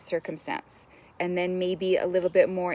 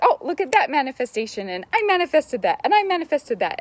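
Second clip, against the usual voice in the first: higher and louder, gloating delight; mock self-congratulation; 7 semitones higher, 5 dB louder than usual. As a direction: crow over your own success, playfully. Patting ourselves on the back.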